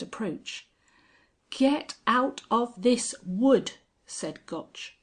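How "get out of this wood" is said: slow, higher, louder raging order. A narrator's reading. Sir John three yards from the Angel, raging. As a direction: spit each word in fury.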